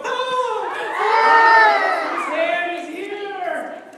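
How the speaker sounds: high, loud hammed-up scream; mock scream. Crowd seeing the ghostly hand, loud mock scream. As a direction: shriek like scared partygoers.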